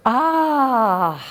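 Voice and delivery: a high, relieved ah of joy; relieved happy ah. Acted. Pilgrim hears an answering voice, high relieved gasp of joy. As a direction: let the relief burst out. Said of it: "his face is all happy".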